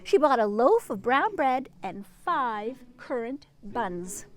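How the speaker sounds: bright storybook read-aloud; reading to children. A narrator's reading. Reading Peter Rabbit aloud to a child, storybook voice. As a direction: tell it like a bedtime story for a child.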